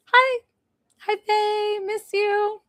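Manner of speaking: very high, held-out affectionate hello; high-pitched greeting. Real, not acted. Live host greeting viewer Faye she misses, high-pitched. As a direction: greet them in a high little squeal of a voice.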